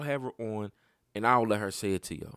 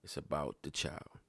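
quietly recalled; 5 semitones lower, 11 dB quieter than usual. Retold or imitated. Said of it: "what my shorty said".

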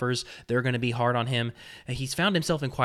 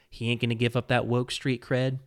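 ordinary talk

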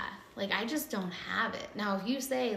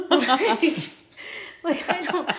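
laughing; 8 semitones higher, 12 dB louder, much slower than usual. Real, not acted. Laughing at her own story.

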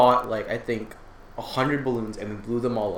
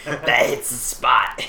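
chain smoker voice; much slower than usual. Pretend. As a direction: drag it out like an old smoker. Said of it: "sound like a chain smoker".

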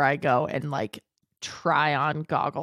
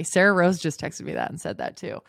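ordinary delivery

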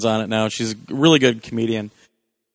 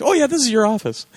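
fake realization; 10 semitones higher than usual. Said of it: "acted quote".

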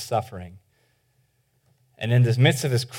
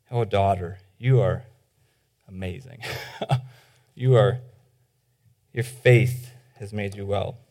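tender praise; 6 semitones lower, 5 dB quieter than usual. Acted. Jesus speaking tenderly to woman.

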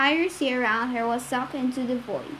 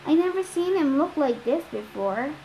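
usual speaking voice

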